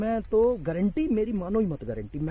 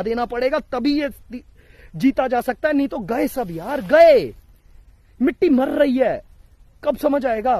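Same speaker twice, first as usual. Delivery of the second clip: high, loud impassioned shouting; emotional loud plea; 6 semitones higher, 8 dB louder than usual. Real, not acted. Vlogger begging viewers to act on pollution, loud and emotional. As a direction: pour it all out, louder and higher. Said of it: "मैं तेज बोल रहा हूं, मैं गुस्से से बोल रहा हूं".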